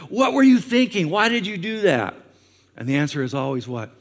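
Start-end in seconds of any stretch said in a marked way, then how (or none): 0.1-2.1 loud, much higher, scolding disbelief; exasperated parent. Retold or imitated. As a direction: play the fed-up parent seeing the wreck. Parent scolding kid over mess.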